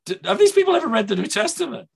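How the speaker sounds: high, quick, disbelieving question; incredulous. Retold or imitated. Preacher recalling his reaction to other people, incredulous.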